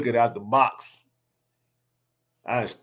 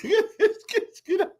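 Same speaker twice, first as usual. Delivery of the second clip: laughing. Real, not acted. Laughing retold eviction.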